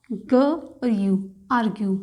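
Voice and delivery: ग और यू drawn out slowly, sound by sound; slow spelling. Shown as a demonstration. (बहुत धीरे, एक-एक ध्वनि अलग बोलें)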